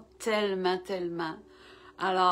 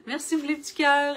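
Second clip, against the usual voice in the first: laughing; 5 semitones higher, 5 dB louder, faster than usual. Real, not acted. Laughing at hearts in chat.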